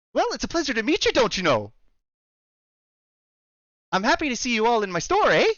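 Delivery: high, perky Canadian shopkeeper's welcome; Canadian accent. Acted. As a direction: greet customers in a hammed-up Canadian accent. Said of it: "I am trying to do a Canadian accent".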